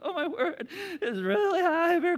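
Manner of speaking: high, loud nervous laugh-cry; scared at the top of the lift hill. Retold or imitated. Rider frightened at the top.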